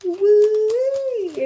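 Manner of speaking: loud woo-wee. Real, not acted. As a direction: let out a big amazed holler. Reacting to her own story.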